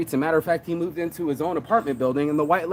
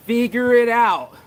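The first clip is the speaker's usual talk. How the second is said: slow, louder and higher, each word weighted; stressed saying; 7 semitones higher, 7 dB louder, slower than usual. Acted. Stating the crew's motto to the viewers, slow and forceful. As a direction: lay down the motto slowly and hard. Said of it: "is one of our fucking sayings".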